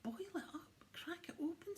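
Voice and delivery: high whispered Santa; whispered mock cry. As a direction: Santa echoing the elves, high and hushed. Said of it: "Santa cried".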